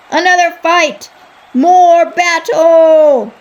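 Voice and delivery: high, loud, stretched cheer; the crowd cheers for another fight, high and loud; roaring crowd. Acted. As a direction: whip the crowd up.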